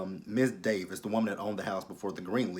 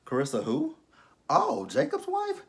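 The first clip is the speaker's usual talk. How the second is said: high, slow fake-innocent "Oh, Jacob's wife?"; feigned innocence, pitched up and slow; mock innocent neighbor. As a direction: play dumb in a sweet put-on voice. Plays an innocent gossiping neighbor.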